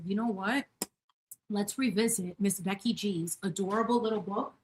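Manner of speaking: normal talking voice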